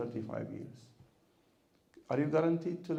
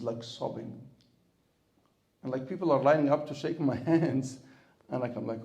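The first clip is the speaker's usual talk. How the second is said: normal talking voice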